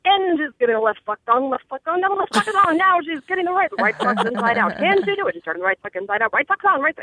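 fast rising auctioneer patter. Shown as a demonstration. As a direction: rattle it off like an auctioneer. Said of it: "do, like, an auctioneer voice".

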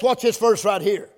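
ordinary talk, nothing marked

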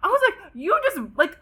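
high indignant question, pitch climbing; outraged disbelief. Retold or imitated. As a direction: scoff at the word Friends. Outraged at being offered friendship.

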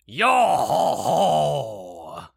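loud, slow pirate hail. Acted. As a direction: bellow it like an old pirate. GM voices approaching pirate.

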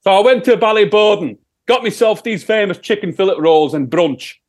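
put-on Sheffield accent. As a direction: answer as a broad Sheffield rock singer. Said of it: "it's my Sheffield accent".

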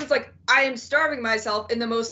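usual speaking voice

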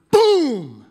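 loud, drawn-out imitated boom; explosive boom. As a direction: boom it out high and long like a blast.

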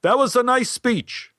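high-pitched call across a theater; imitated shout at ordinary volume. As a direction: call it out to someone on stage. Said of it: "he called out to me".

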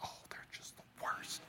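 whispered, as a catty aside; snide whisper. Retold or imitated. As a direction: hiss it under your breath, mocking the bitter listener. Mocking a bitter inner voice.

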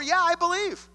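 bright, certain yes; sure believer. Acted. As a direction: declare belief with a lift. Preacher voices a sure believer.

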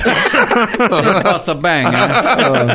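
very slow mock Italian accent, laughing; drawn-out hammy Italian accent. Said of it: "Now you're dipping into Father Guido Sarducci".

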